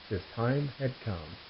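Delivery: soft deadpan delivery; quiet punchline. Acted.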